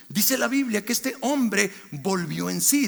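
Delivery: usual speaking voice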